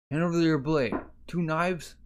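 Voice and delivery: high-pitched acted order; stern demand. Guard disarming the accused suspect, demanding.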